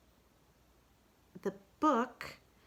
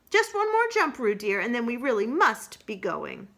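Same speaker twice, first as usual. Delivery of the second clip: higher, louder call; Kanga calling Roo; 5 semitones higher, 7 dB louder than usual. Acted. Kanga hurries Roo, louder. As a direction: call your child to hurry.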